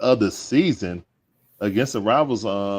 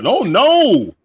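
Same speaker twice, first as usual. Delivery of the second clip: surprised; 11 semitones higher, 9 dB louder than usual. Real, not acted. Close play at first.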